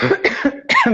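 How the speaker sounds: sharp coughing fit after choking; choking on a drink. Real, not acted. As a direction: cough hard, caught on water. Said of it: "Me engasguei com a água".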